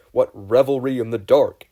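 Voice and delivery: loud theatrical exclamation; dramatic call. A narrator's reading. Classmate calls out in shock, loud and theatrical. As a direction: cry it out like a grand stage line.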